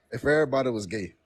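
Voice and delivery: ordinary delivery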